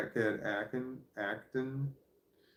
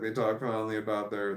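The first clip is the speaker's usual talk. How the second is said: ordinary delivery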